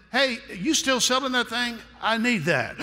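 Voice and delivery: suddenly interested buyer; mock eager customer.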